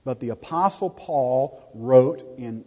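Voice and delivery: normal talking voice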